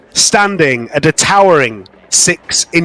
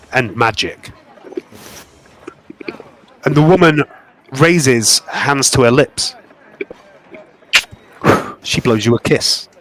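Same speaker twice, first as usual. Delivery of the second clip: game master narration; 4 semitones lower than usual. A narrator's reading. Game master describing a goddess vision, hushed narration.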